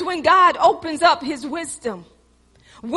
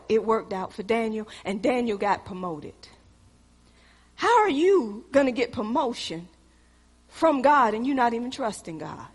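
usual speaking voice

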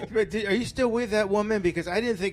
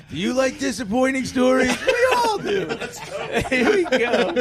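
loud, high mock sales pitch; tongue-in-cheek salesman; mock hype; 8 semitones higher, 5 dB louder than usual. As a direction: pitch it like a bad advert, amused. Mock hype for own dull story.

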